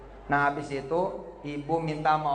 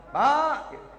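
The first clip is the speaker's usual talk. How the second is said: calling out; 9 semitones higher than usual. Retold or imitated. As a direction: call to him from another room. Wife calls from the bedroom.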